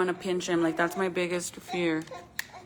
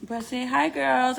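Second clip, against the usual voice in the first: ordinary talk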